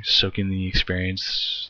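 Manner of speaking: ordinary talk, nothing marked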